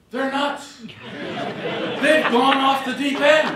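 chuckling as he lands the punchline; laughing jab. Real, not acted. Joking jab at Democrats.

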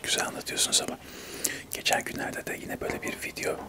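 normal talking voice

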